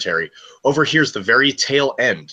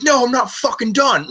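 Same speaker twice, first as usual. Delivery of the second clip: much higher, shrieking mock rant; shrill shout; 14 semitones higher than usual. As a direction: screech it like she's losing it. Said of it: "She's like".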